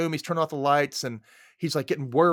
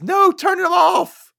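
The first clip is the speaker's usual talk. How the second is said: slow, high, loud terrified shout; panicked shout; 11 dB louder, much slower than usual. Retold or imitated. Panicking about the lights, he shouts at his brother. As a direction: bellow it in panic. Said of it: "he says".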